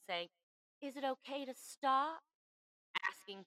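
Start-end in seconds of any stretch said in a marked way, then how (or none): 0.8-2.2 put-on higher, slow little-boy voice. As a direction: ask timidly like a small boy. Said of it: "in his little boy voice".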